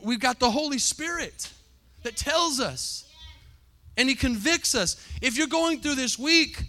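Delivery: building sermon climax; full-force preaching. Real, not acted. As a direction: preach it out over the music.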